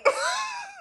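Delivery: high squealing laugh; shrieking laugh. Real, not acted. Laughing at snake story.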